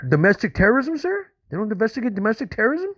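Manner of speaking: can't believe what you heard; incredulous. Real, not acted. Caller disbelieving the FBI refusal.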